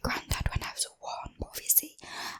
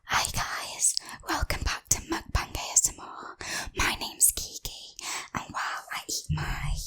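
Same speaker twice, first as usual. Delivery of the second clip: ASMR whisper. Real, not acted. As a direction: whisper it close to the mic.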